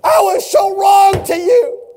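high, loud and slow crying plea; tearful wail. Retold or imitated. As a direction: sob it out with regret. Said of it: "tears were streaming down".

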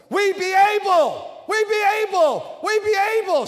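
loud, high, emphatic chant; convinced shout. Shown as a demonstration. Preacher leading the church in a loud chant. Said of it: "I'm talking about convinced".

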